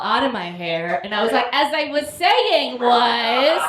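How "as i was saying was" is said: slow deadpan. Retold or imitated. Crawls out, resumes argument.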